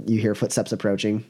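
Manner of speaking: ordinary talk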